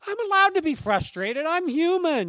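high, loud mock indignation; over-the-top defensive outcry; exasperated protest. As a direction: plead your case in hammed-up frustration. Acted frustrated retort to horrified friends.

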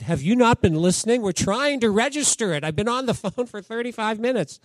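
loud exasperated phone rant; practically yelling. Retold or imitated. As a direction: snap back at the tech guy, loud and fed up. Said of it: "I'm practically yell".

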